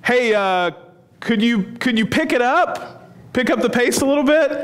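impatient Inigo. Retold or imitated. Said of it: "and he goes".

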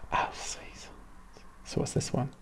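hushed whisper. Real, not acted. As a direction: whisper to viewers before the clip. Whispering before video.

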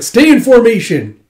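high, loud officer's yell; shouted command. Retold or imitated. General to troops in the fray, shouted command. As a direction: order the ranks to hold.

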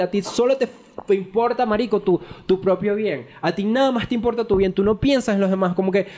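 high-pitched, indignant venting; worked-up anger. Real, not acted. Partner venting at the boyfriend who used them, worked-up anger. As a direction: let real resentment push the pitch up. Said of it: "perdón que me arreche".

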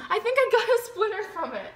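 high laughing complaint. Real, not acted. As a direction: squeal it through a laugh. Splinter hurts, laughing shriek.

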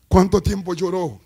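loud and slow, calling out the question; clamor fuerte. Real, not acted. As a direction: cry it out to the congregation. Predicador clama con fuerza. (clamando fuerte y lento)

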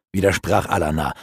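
usual speaking voice

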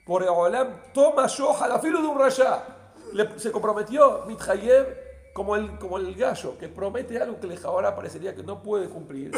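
high, very loud sermon delivery; impassioned preaching. Real, not acted. Rabbi proclaiming a teaching to his listeners, impassioned preaching. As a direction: proclaim it forcefully to the congregation.

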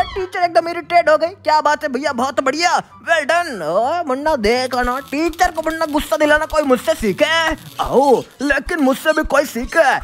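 normal talking voice

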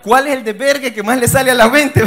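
pregunta gritada entre risas; suéltalo gritando y riendo; laughing outburst. Acted. Pregunta exagerada con risa. (pregunta a gritos entre carcajadas)

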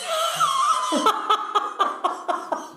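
very high, long peal of laughter; cackling laugh. Real, not acted. Joke teller after the chips punchline, high cackle. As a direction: crack up at your own punchline.

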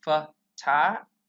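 slow "Photographer", broken into fuh, TAH, gruh, fer; syllable by syllable. Shown as a demonstration. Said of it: "tôi sẽ đọc là photographer".

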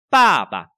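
first pa loud and falling, second light; decisive first pa. Shown as a demonstration. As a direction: hit pa hard, then skim pa. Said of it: "rất là quyết liệt". (pa đầu mạnh, pa sau lướt qua)

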